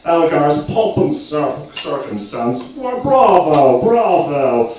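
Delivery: posh concertgoer. Retold or imitated. At the Royal Albert Hall praising Elgar, posh English gushing. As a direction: gush like a toff at the Proms. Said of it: "in the Royal Albert Hall going".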